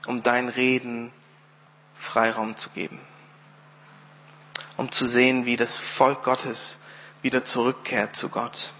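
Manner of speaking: low, measured prayer with long pauses. Real, not acted. Preacher praying to God for the congregation, measured prayer. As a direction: pray unhurriedly, phrase by phrase. Ich bete kurz.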